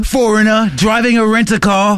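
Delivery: high mock British tourist; British accent. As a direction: ham up an English accent. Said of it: "Just like that".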